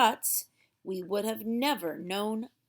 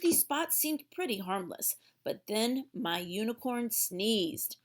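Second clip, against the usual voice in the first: ordinary delivery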